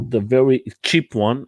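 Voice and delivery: ordinary delivery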